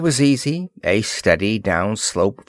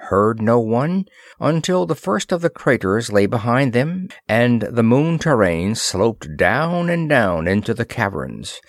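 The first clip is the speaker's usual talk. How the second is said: ordinary delivery, nothing marked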